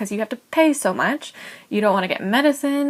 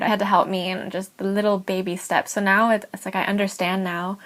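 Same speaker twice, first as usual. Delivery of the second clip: ordinary talk, nothing marked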